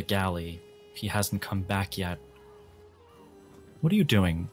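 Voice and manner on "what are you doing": usual speaking voice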